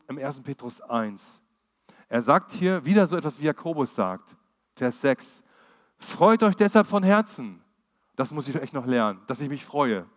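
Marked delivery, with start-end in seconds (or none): 6.0-7.6 high and loud, bursting with joy; joyful proclamation. Acted. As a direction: proclaim the verse like a cheer. Bible verse proclaimed aloud.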